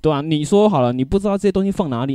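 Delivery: ordinary delivery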